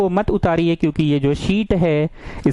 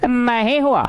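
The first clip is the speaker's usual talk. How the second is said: higher, swooping singsong on each half; drilled catchphrase; 6 semitones higher, slower than usual. Shown as a demonstration. Teacher drilling the rule into students, in exaggerated singsong. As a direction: chant the rule in two big falling swoops.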